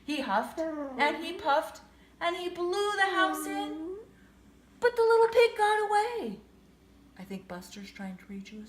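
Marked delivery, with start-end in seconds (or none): none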